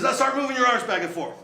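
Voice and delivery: high, loud mocking command; shouted drill order. Platoon leader humiliates recruits on the ground, high shouted order. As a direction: bark it down at the recruits. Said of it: "He goes".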